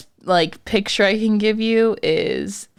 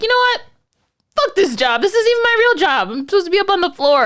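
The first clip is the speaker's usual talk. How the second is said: much higher and louder, fed-up venting; fed-up outburst; 12 semitones higher, 4 dB louder, faster than usual. Retold or imitated. Hospital worker telling off a rude man, fed-up and loud. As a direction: blow up like you're done with this job. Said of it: "So I was like".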